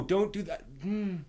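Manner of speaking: usual speaking voice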